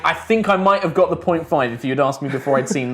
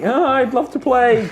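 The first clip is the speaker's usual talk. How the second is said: high, slow, overeager; eager; 10 semitones higher, 3 dB louder than usual. Retold or imitated. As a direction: gush like a keen newbie. Eager mock reply in story.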